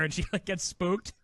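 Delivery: ordinary talk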